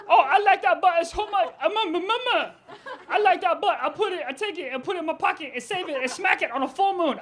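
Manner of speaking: high, gushing put-on excitement; fake enthusiasm. Overselling the lie to his friends, high mock enthusiasm. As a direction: babble phony admiration to fit in. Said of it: "I gotta lie".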